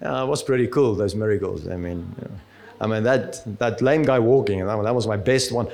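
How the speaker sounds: a lightly voiced smug Jesus shrugging off his miracles; mock-boastful Jesus. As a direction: brag like it was nothing. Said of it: "imagine somebody said something so great and Jesus was".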